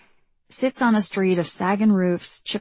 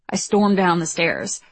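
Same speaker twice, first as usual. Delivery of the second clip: ordinary delivery, nothing marked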